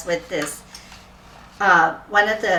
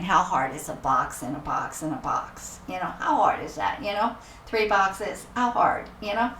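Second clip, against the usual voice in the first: dismissive thought; 10 dB quieter than usual. Retold or imitated.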